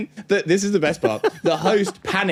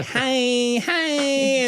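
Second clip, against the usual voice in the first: much higher sing-song diva hello; 13 semitones higher than usual. Retold or imitated. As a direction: wave hello like a diva on the stairs.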